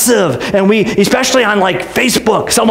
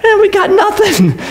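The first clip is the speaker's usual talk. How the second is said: sheepish admission; 10 semitones higher than usual. Retold or imitated. Accusers admit no evidence.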